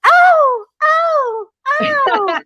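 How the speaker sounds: very high drawn-out ows; hammed-up rabbit howls of pain; mock pain yelps. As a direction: wail as if getting whacked. Rabbit fakes being hit.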